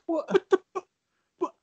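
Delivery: high, sputtering disbelief; broken-off, stammered outrage, imitating himself; dumbfounded. Teen on phone hearing refusal again, dumbfounded.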